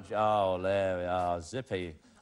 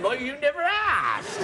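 high, drawn-out mimicking shout; hammed-up loud echo of her remark; mock exclamation; 7 semitones higher, 3 dB louder, slower than usual. Host teasing contestant over what she said, hammed-up imitation. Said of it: "You said".

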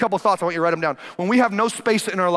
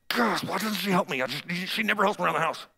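soft grumbling thought to herself; muttered inner thought; 2 semitones lower, 7 dB quieter, faster than usual. Retold or imitated. Martha silently resenting Mary, soft inner grumble. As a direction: grumble it under your breath. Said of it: "she just thinks it".

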